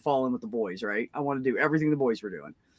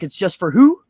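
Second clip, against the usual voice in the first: taunting boast; 9 semitones higher, 5 dB louder than usual. Retold or imitated. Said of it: "I was like".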